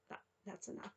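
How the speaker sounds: losing her voice, half whispered; raspy whisper. Real, not acted. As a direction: voice fading into a rasp. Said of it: "I'm losing my voice".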